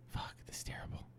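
frustrated unvoiced whisper. Real, not acted.

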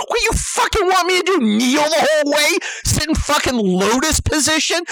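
high, incredulous rapid questioning; worked-up comic rant; sarcastic outrage. Acted. Sarcastic questions to drum owner.

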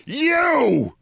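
high, drawn-out accusing cry; retold shout. Storyteller re-enacts shouting at his rival, high drawn-out mock shout. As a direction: cry it out high, held long. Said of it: "I stop smiling and I shout at Bill Maher".

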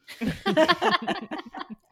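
bright high burst of real laughter; laughing. Real, not acted. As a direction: laugh out loud at the memory. Laughs at her father's line.

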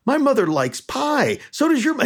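high, animated quote voice; mock-excited and high-pitched; excited comic retelling. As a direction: pitch it way up, imitating eager comparisons. Acting out Rickles' mothers routine.

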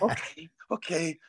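soft, slow, amused okay; smiling it off. Retold or imitated. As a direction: shrug it off gently with a smile. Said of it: "He just kept smiling it off".

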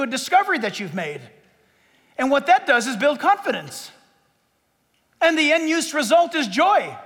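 loud, high, fervent pulpit delivery; rising preacher's fervour, loud and lifted; full-force preaching. Acted. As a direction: preach it out to the whole room.

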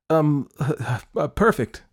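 embarrassed hesitant repeat; flustered repeat. A narrator's reading. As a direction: blurt it nervously after false starts. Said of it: "she said again".

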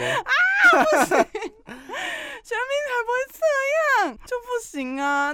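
high, slow, drawn-out whine; whiny wail. Real, not acted. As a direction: whine in laughing protest. Protesting about her idol.

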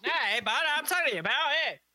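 high, hammy Canadian cartoon voice; South Park Canadian accent. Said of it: "It's South Park Canadian".

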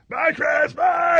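high screamed bye, hammed up; Pee Wee Herman voice. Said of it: "scream like Pee Wee Herman".

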